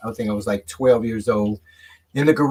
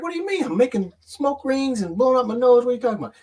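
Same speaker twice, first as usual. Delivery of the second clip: much higher, baffled mock kid voice; clueless younger self; 10 semitones higher, faster than usual. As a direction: play your naive twelve-year-old self, indignant. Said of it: "I'm like".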